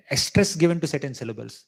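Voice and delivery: usual speaking voice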